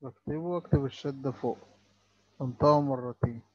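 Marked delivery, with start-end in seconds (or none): none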